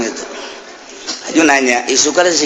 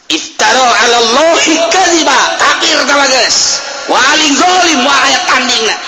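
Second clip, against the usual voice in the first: fiery preaching; 12 semitones higher than usual. Acted. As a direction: preach it hard to the congregation. Preaching at full force.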